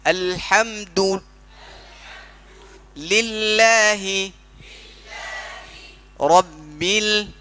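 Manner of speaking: अल्हम्दु लिल्लाहि रब्बिल drawn out very slowly, lips rounded on the दु; tajweed recitation. Shown as a demonstration. Teacher models slow, careful Quran recitation for the student. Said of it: "तो वो है perfect तजवीद".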